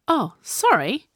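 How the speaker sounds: high, drawn-out fake apology; mocking. A narrator's reading. Sage teasing Viola about the troll buyers, mocking. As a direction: draw out a mock apology. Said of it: "Sage mocked her".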